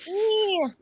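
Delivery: mock the sister's Staten Island accent; drawn-out dismissive Staten Island yeah. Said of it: "very distinct Staten Island accent".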